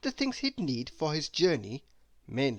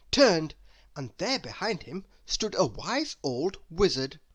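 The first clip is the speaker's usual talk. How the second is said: plain reading voice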